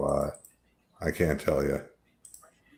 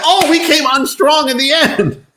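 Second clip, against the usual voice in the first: mocking coach voice; 5 dB louder than usual.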